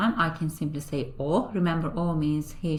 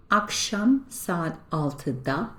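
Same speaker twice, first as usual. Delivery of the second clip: slow model pronunciation; much slower than usual. Shown as a demonstration. Teacher models Turkish phrase.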